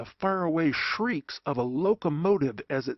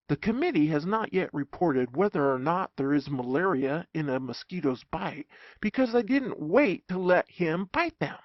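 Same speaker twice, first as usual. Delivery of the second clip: ordinary delivery, nothing marked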